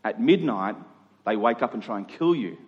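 ordinary talk

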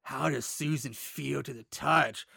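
leer it out quietly; creepy whisper. Retold or imitated. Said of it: "the voice creepy".

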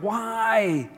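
a high, lightly voiced cry of pain; mock anguished cry. Job crying out to God, mock anguish. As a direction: cry out the why like Job in agony. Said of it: "he cried".

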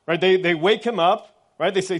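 usual speaking voice

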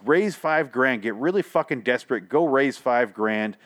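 ordinary talk, nothing marked

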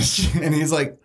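usual speaking voice

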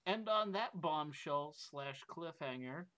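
slow announcer build to a high, loud finish; show sign-off. Pretend. Host closing the podcast in a slow, big sign-off voice. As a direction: draw it out, then belt the farewell.

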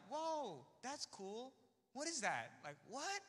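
high-pitched baffled reaction; surprised. Retold or imitated. Friends hearing about theology, puzzled surprise. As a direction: imitate friends taken aback, voice jumping up.

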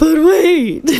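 high, loud, laughing character voice; Taco voice. Acted. Reader trying out the character for the blurb, Taco voice. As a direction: burst into a showy impression. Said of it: "should I do the Taco".

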